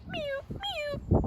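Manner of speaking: high mock cat meow; catbird meow. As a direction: mew like a hungry cat. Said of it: "makes sounds like an angry, hungry cat".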